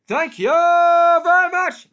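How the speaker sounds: high, loud and slow; over-the-top comic sign-off. Acted. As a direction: belt it like a lounge comic.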